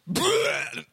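loud mock retch; big cartoonish "bleh" of throwing up; imitated vomiting. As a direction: spit it up like a huge fish. Imitates fish spitting out Jonah.

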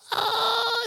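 high mock sob; a hammed-up girly cry; mock wail. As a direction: fake wailing sob. Mock girl crying.

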